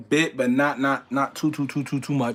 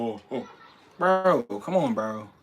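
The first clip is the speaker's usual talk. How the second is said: ordinary talk, nothing marked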